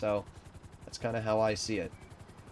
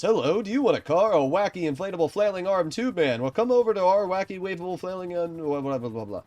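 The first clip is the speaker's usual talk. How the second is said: high, loud car salesman pitch; hard sell ad; 9 semitones higher, 8 dB louder than usual. Shown as a demonstration. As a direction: hype it like a cheesy car ad. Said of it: "the car salesman voice".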